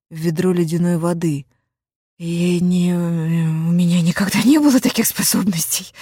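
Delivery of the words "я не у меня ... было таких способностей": raspy whisper. Acted. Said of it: "хриплым шёпотом возразила я".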